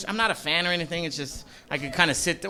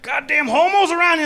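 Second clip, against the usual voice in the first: mock homophobe; 11 semitones higher, 6 dB louder than usual. Comic mocking a homophobe, high and loud. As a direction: sneer it like a bigot. Homophobe voiced in standup bit.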